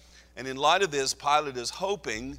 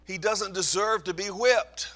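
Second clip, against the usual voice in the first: louder, higher protest; crying out; 8 semitones higher, 6 dB louder than usual. Pretend. Preacher crying out to the governor, louder and higher. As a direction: call out to stop him. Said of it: "I want to cry out and say".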